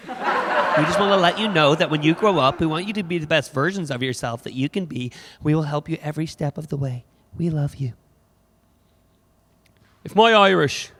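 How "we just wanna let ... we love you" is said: put-on earnest American accent; American parent. American parents encouraging their children, earnest American accent. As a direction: reassure the kids in sincere American tones. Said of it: "hear Americans talking to their kids".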